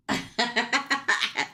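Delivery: falling giggle; real laugh. Real, not acted. Reader laughing at her dog, falling giggle.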